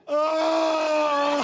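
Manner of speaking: loud, high, drawn-out fake sob; mock crying wail. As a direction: burst into a hammed-up wail. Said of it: "I would cry during a porn".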